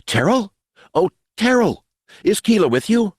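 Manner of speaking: high, hopeful woman's cry; shrill with hope. A narrator's reading. Mother hearing a lost boy, shrill with hope. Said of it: "shrill with hope".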